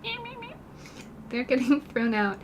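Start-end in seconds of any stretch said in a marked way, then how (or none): 0.0-0.6 Muppet meeping. Retold or imitated. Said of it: "They're like".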